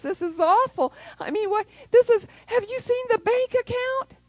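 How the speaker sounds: mock whining, rising to high, loud panic; whiny complaining prayer. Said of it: "quit complaining about it".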